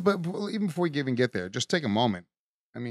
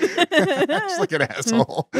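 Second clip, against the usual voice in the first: high, slow words through laughter; laughing; 11 semitones higher, much slower than usual. Real, not acted. Cracking up about Elaine, high laughing words. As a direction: squeeze it out mid-laugh.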